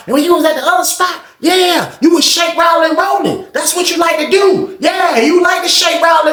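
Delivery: high shouted taunts; retold blasting. The boy blasting Sean from the tier, high shouting. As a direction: blast him in front of everyone.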